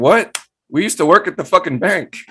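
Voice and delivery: disbelief. Retold or imitated. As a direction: say it like you can't believe it. Old coworker owns dispensary.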